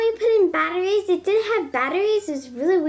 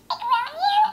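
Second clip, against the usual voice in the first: high squeaky sped-up echo of the toy; a tiny pet toy chirping the words back; toy chipmunk echo; 9 semitones higher than usual. Real, not acted. Talking pet toy repeating a girl's greeting, high and squeaky.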